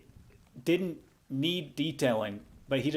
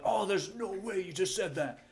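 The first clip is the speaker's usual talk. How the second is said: dancing angry; 7 semitones higher, 4 dB quieter than usual. Retold or imitated. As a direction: mimic a little hopping tantrum. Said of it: "angry, so, like, just dancing angry".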